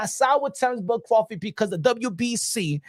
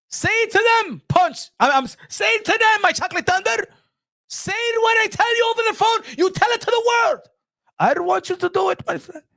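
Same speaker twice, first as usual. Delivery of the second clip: mock Turkey Al Sheikh egging him on; 11 semitones higher than usual. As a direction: cheer him on like a pushy promoter. Voicing Turkey Al Sheikh egging Crawford.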